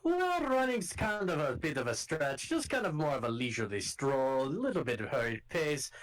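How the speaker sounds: gruff Scottish guard calling out to strangers; rough Scottish accent. Acted. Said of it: "[name] is Irish".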